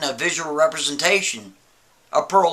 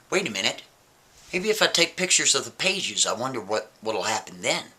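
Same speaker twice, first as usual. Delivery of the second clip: muttering to himself; 6 semitones lower, 6 dB quieter than usual. Retold or imitated. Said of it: "So he's like".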